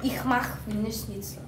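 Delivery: softly spoken German phrase in an accent. Shown as a demonstration. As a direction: show off a modest German accent. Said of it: "German accent is very, very good".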